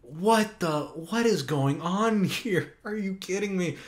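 half-laughing "are you kidding me"; reliving his reaction to the cringe scene; retold disbelief. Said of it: "I was just like".

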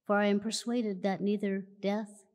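usual speaking voice

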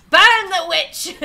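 loud, high, drawn-out mock outcry; mock mob cry. Streamer imitating outraged social media, hammed-up mob cry. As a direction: call it out slowly like an angry mob.

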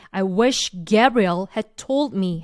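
very slow, told pressed hard and falling; stress on told. Shown as a demonstration. Teacher drilling students, slow stress on "told". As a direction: lean on the word "told", slowly. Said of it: "이렇게 눌러주시면 돼요".

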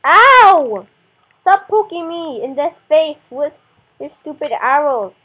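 loud pained yelp then snapping; exasperated poked game character. Real, not acted. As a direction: shout at the skeleton, annoyed. Hit by skeleton arrows.

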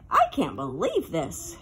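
a low, lightly voiced despairing wail. A narrator's reading. Benji stunned by the art room line, low dismayed wail. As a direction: groan it out, a touch of despair. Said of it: "Benji wailed".

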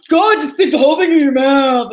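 loud mocking shout of nagging viewers; mock nagging people. Mocking the viewers who nag her, a loud yell. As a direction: mimic the annoyed crowd yelling at you. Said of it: "and people go".

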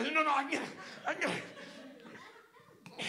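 put-on high, strangled kid insisting; hammed-up straining voice, words squeezed; straining with effort. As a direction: force it out while wrestling a stuck lid. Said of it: "I am straining".

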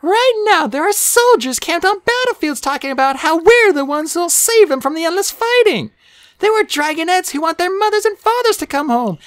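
put-on high-pitched, earnest young dragon voice; Sunny, hopeful dragonet. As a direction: speak high, full of hope for the prophecy.

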